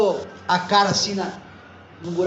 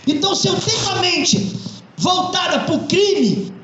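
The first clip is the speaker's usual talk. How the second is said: raised, ringing pulpit voice; fervent preaching; 8 semitones higher than usual. Acted. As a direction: preach it to the congregation with conviction. Pastor preaches to congregation.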